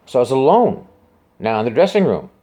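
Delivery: usual speaking voice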